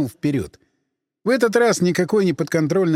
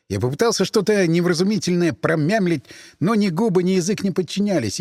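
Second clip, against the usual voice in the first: plain reading voice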